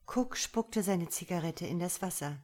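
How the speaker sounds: ordinary delivery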